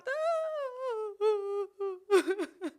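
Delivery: high, drawn-out fake wail; mock ugly cry. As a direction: bawl in comic exaggeration. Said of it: "I'ma do the ugly cry".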